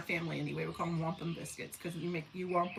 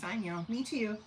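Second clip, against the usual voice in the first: usual speaking voice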